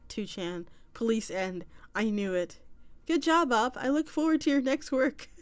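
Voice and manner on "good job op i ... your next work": loud, higher sarcastic congratulations; hammed-up applause for his failure; mock cheer. Mocking cheer at arrested poster.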